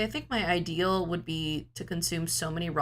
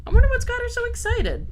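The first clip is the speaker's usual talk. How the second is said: high excited pet talk; 8 dB louder, faster than usual. Real, not acted. Talking about excited cat.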